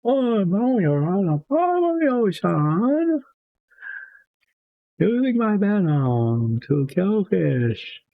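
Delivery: very high, then slow and drawn out; a squeaky mock minister from Princess Bride; Princess Bride minister impression. Crafter gives the octopus a very high minister voice. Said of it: "It'll talk like that".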